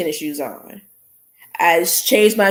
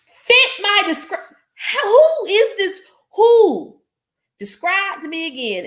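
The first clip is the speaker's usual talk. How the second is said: incredulous disbelief; 12 semitones higher than usual. Retold or imitated. Incredulous at being profiled again.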